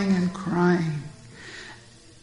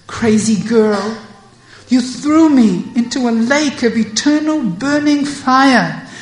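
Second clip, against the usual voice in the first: higher and louder reproach; reproachful scolding; 4 semitones higher, 7 dB louder than usual. Acted. Radha scolds Vishaka, loud and reproachful. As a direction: demand she remember.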